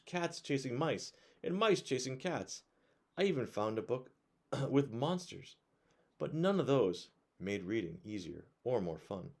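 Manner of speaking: put-on bright, lively read-aloud voice for kids; reading a picture book to children at bedtime; storybook narration.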